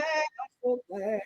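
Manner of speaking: a hushed, slow echo of the first line. Real, not acted. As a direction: say it again under your breath, slowly.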